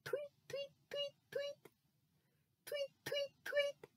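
tiny high chirping tweets; high soft bird tweets. Acted. Imitating a bird.

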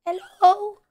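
high, drawn-out hello; bird voice. Shown as a demonstration. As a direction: call sweetly to a bird in a high voice. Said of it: "that's my bird voice".

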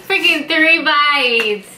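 usual speaking voice

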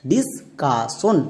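Discussion very slowly in parts, dis... ka... shon; syllable by syllable. Shown as a demonstration. Teacher has pupils read along, discussion slowly syllable by syllable. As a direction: break discussion into separate, drawn-out syllables. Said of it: "একসঙ্গে উচ্চারণ করো".

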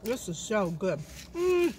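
chewing while talking; talking through a bite; mouth full. Real, not acted. Seen 4: Burrito held at mouth, bites and chews while speaking in car.

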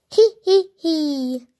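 mischievous villain snicker; sneaky giggle. Acted. Sneaky plan to scam.

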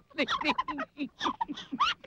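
very high, squealing laugh; uncontrollable laughter. Acted. Narrator cannot stop laughing, helpless. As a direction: collapse into helpless giggles.

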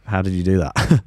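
ordinary talk

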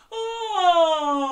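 loud open-throated oh; big open oh like at the doctor's. Shown as a demonstration. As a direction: open your throat and call out oh. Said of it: "Can you say this for me?".

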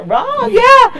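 loud, high cheer for a friend; excited shout-out. Real, not acted. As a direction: call his name out with joy.

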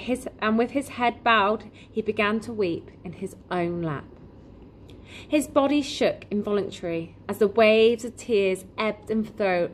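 ordinary delivery, nothing marked

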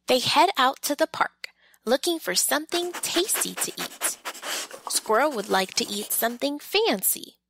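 ordinary delivery

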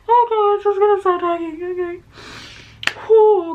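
high, loud words breaking into laughing tears; can barely get it out through laughing sobs; laugh-crying. Real, not acted. Streamer losing it over the video, laugh-crying.

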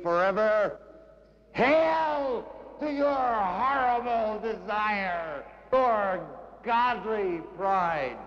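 high, fervent litany, slowly descending; exalted poetry declamation. Acted. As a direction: proclaim it like a hymn of praise, then ease down. Poem builds to exhortation.

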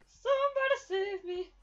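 hammed-up plea for rescue; mock desperate plea. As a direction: cry out like a damsel in distress. Calling for help while chased.

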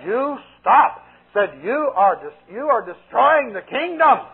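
high, slow, commanding demon voice; principality warning the preacher, commanding. Retold or imitated. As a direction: order him to stop, up high.

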